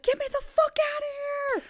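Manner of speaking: very high, fast, frantic cry from the pit; panicked plea. Retold or imitated. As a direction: squeal it out, desperate to escape. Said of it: "she's like".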